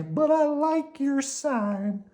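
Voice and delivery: high, slow worm voice; shyly pleased; Slimy pleased. Acted. Slimy thanks Oscar for the sign, in a high pleased voice.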